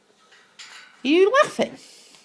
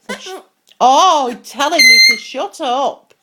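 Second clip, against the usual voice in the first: ordinary delivery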